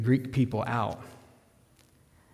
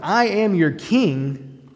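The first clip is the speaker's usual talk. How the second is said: kingly proclamation; 6 semitones higher, 4 dB louder, much slower than usual. Acted. Jesus voiced as king.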